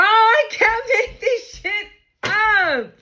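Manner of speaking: almost crying from excitement; ecstatic, near tears. Real, not acted. Ecstatic at the cards.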